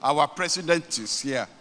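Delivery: normal talking voice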